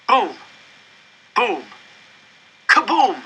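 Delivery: escalating mock explosions; bomb sounds. A narrator's reading. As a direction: build the bomb blasts to a big finish. Narrator voices falling bombs.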